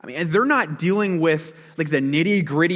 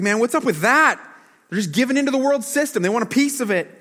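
indignant complaint; 7 semitones higher, 4 dB louder than usual. Retold or imitated. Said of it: "He's like".

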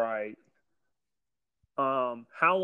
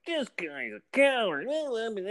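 put-on snotty kid; 10 semitones higher than usual. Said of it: "snotty little Mace is like".

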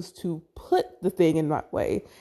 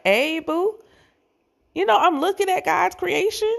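high-pitched, flirty greeting to oneself; playful self-admiration; 11 semitones higher than usual. Retold or imitated. Catching herself in the mirror, playfully admiring. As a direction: pitch it way up, hyping your own reflection.

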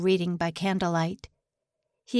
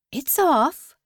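sharp, loud, higher snap; snapped; 7 semitones higher, 6 dB louder than usual. Acted. General snapping at Soon, sharp and loud. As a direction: bite the words off hard. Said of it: "the general snapped".

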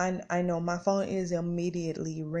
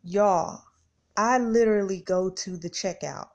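normal talking voice

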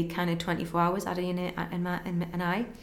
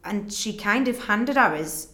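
ordinary talk

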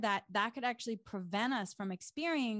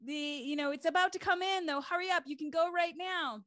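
lifeguard urging; 8 semitones higher than usual. Retold or imitated. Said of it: "the lifeguard across the way was saying".

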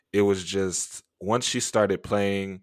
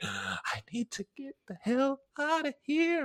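much higher, strained panicked thought. Retold or imitated. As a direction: squeak it in rising terror. Retold panic to escape.

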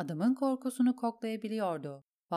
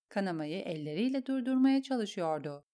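ordinary delivery, nothing marked

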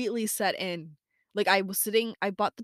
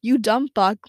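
louder mock insult; playful yell; 8 dB louder than usual. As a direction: toss out a jokey yelled insult at friends. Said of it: "are things that I yell at my friends".

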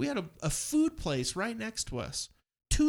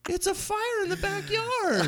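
mock disbelief; slower than usual. Mocking firemen.

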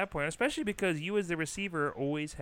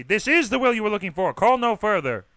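loud, higher declamation; mock-grand phone announcement; proclaimed greeting; 6 semitones higher, 10 dB louder than usual. Shown as a demonstration. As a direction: boom it out like a herald. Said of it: "I should, I should be".

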